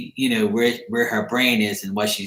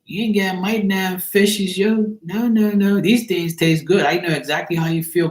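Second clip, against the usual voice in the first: much higher-pitched, lightly acted protest; guard the snack in a raised voice; playful mock refusal; 8 semitones higher than usual. Acting out keeping the fishies from a stranger, playful mock refusal.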